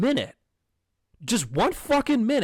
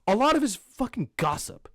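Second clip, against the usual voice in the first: ordinary delivery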